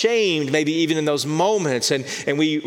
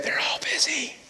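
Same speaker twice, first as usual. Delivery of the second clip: put-on a child's small hushed whisper; soft whispered hello on the phone; 4 dB quieter than usual. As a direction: breathe it out like a hiding four-year-old. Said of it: "Jimmy then whispered".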